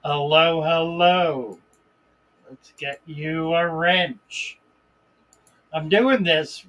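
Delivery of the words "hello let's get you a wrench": usual speaking voice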